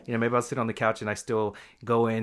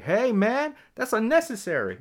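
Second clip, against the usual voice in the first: much higher, louder call to stop a fight; 12 semitones higher, 4 dB louder than usual. Retold or imitated. A little boy yelling at men fighting, high call. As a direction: shout it across to break it up. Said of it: "you see that and you're like".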